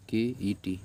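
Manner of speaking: ordinary delivery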